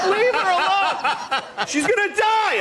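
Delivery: horrified bystanders yelling at the man, outraged; outraged bystander shout. Retold or imitated. Honking onlookers shouting.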